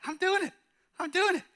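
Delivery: put-on very high, squeaky child voice; thrilled little kid. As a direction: play an amazed kid in a tiny high voice. Acted child thrilled on bike.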